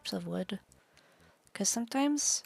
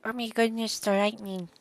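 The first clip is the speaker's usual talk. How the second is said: put-on louder Canadian accent exclamation; 3 semitones higher, 6 dB louder than usual. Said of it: "I'm trying to do a Canadian accent".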